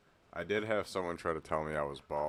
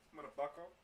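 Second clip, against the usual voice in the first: pet talk voice; 10 semitones higher, 4 dB quieter than usual. Real, not acted. Talking to his dog.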